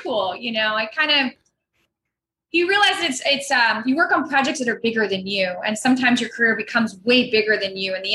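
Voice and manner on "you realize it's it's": usual speaking voice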